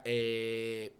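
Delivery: drawn-out, level, loud thinking "é"; hesitation filler. Shown as a demonstration. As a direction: hold the filler while searching for words. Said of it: "daquela hora do pensando aqui".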